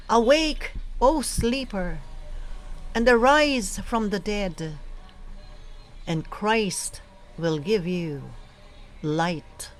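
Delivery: ringing scripture proclamation, strongest at the start. Shown as a demonstration. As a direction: proclaim it like an announcement, pausing between phrases. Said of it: "Read this quotation as a proclamation".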